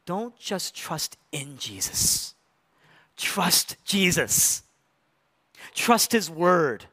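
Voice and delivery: slow, deliberate, word by word; slow emphatic. Real, not acted. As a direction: let each word land slowly. Preacher's slow emphatic exhortation.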